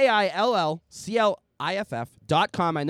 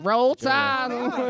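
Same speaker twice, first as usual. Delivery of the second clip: cheer; 6 semitones higher than usual. Real, not acted. Cheering for Alabama fan.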